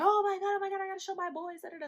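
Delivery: high-pitched gushing, rattled off fast; imitated super excitement. Boyfriend hearing it's twins, imitated high excited babble. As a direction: gush like an overjoyed boyfriend. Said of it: "he just goes super excited".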